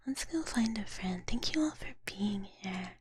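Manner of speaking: ordinary talk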